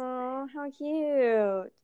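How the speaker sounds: long, drawn-out "aww" of onlookers; imitated cooing. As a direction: coo it very slowly, mimicking the friends. Friends cooing at dramatic hug.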